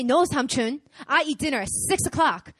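loud, mildly defiant refusal; mock defiance. Acting out a defiant houseguest.

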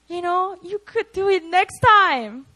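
encouraging. Acted. Jesus encouraging Peter after he sank, loud encouragement. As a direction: cheer him on for another try. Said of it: "that's what Jesus was saying to Peter".

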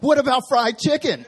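high, loud, eager blurt; sister's blurted question. Retold or imitated. Sister bursting to ask the pastor about fried chicken, high and loud. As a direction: blurt it out like you couldn't hold it in. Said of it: "She's like".

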